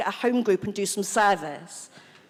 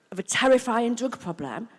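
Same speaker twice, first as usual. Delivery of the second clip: ordinary delivery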